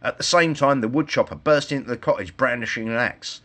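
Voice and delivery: plain reading voice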